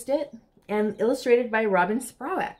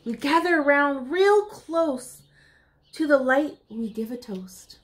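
put-on reading to children; 5 semitones higher than usual. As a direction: read it like a bedtime picture book for little ones. Reads rhyming picture book aloud.

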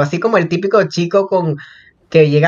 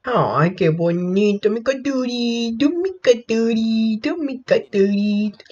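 mimoso; 4 semitones higher, 5 dB quieter, slower than usual. Real, not acted. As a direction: coo slowly to a little pet. Coos over her little pet. (con voz mimosa, suave y lenta)

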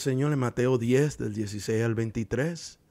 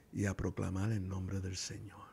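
solemn whisper; 5 semitones lower, 8 dB quieter than usual. Acted. Solemn close of a point.

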